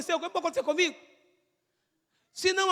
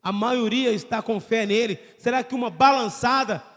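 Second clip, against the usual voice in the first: lower, calm pastoral appeal; prayer-time preaching; 7 semitones lower than usual. Acted. Pastor calling the congregation to close their eyes, calm and low.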